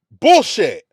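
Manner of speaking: loud scoffing bark; furious fan dismissing empty promises; angry retort. Real, not acted. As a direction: cut him off in disgust. Snaps back at coach's promises.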